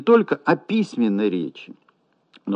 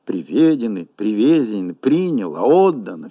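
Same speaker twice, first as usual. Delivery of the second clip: приВЕдены, приВЕзены, ПРИняло, Отдано; wrongly shifted stress; 2 semitones lower than usual. Shown as a demonstration. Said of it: "ошибочно".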